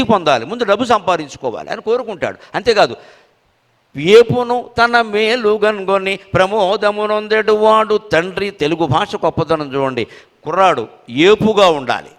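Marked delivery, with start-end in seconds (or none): 4.0-8.5 slow, sung-out recital of a poem line; verse recitation. Acted. Reciting the verse to the audience, slow and measured. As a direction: chant each word of the old verse slowly.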